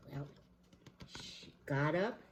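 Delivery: ordinary delivery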